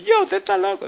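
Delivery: high, laughing exclamation; laughing disbelief. Retold or imitated. Student laughs off the preacher's prediction, high laughing outburst. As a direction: laugh it off, high and loud, calling him crazy. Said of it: "Y empezó a reírse".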